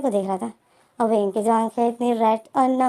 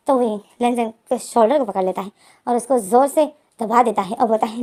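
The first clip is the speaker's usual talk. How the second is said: ordinary delivery, nothing marked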